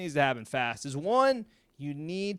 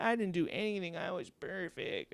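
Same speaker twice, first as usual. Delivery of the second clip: higher, whiny mock-innocent voice; playing the victim; 5 semitones higher, 5 dB quieter than usual. Imitating someone giving the victim response, mock-innocent. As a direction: protest innocence, hammed up. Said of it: "play the one hundred percent victim response".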